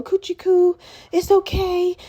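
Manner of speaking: put-on high cooing baby talk; a touch of doting parent cooing. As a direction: coo at the baby, mock-soothing. Parents soothing baby, imitated.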